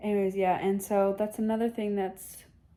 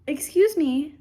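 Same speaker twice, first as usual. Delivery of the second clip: louder, higher indignant excuse me at the cat; 6 semitones higher, 7 dB louder than usual. Real, not acted. Owner scolding her cat walking into the video, indignant. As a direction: snap at the intruding cat with mock outrage.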